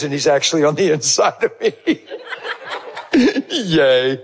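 talking through laughter; laughing. Real, not acted. Speaker telling the audience, laughing while talking. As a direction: let the giggles break in.

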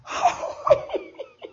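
a high, delighted burst of laughter; high happy laugh; high joyful laugh. Retold or imitated. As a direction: laugh out in surprised joy. Joyful laugh after healing.

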